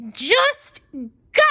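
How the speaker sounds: high, sharp snarl of anger. A narrator's reading. Sandy, red-faced, snarling at SpongeBob to go. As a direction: snap it at him, fed up. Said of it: "she snarled".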